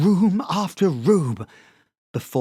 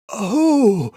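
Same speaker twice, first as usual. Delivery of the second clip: bright, pleased little oh; light up with delight at the news; happy oh; 7 semitones higher, 8 dB louder than usual. A narrator's reading. Red puddle sounding happy at Sebastian's confession. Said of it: "sounding happy".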